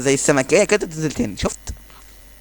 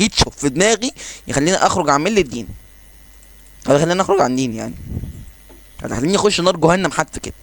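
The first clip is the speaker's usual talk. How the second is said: ordinary talk, nothing marked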